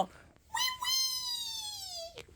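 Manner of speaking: tiny, very high squealed whimper; soft falsetto wail, drawn out; crying little toy. Pretend. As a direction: squeak out a thin pretend baby cry. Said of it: "Basco go".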